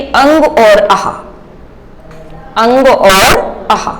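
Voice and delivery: "अंग और आह" said slowly and drawn out, twice; slow repeated demo. Shown as a demonstration. Teacher drilling the class on earlier sounds, slow. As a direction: repeat it slowly so they can copy. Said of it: "क्या बताया था सबसे पहले?".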